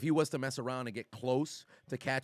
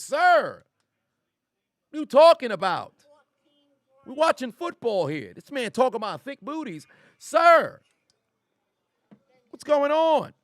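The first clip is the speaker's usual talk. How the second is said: much higher, louder incredulous "Sir"; exasperated disbelief; 12 semitones higher, 11 dB louder than usual. Real, not acted. Streamer scolding chat mid-game, high exasperated disbelief. Chat talks about booties.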